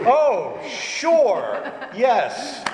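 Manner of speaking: high, loud, drawn-out yes; hammed-up eager acceptance; exaggerated agreement. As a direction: agree with mock gusto, pitch leaping up. Acted eager agreement on phone.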